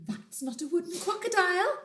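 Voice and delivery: sing-song bird. Acted. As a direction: chirp the warning like a little bird. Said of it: "sang the roly-poly bird".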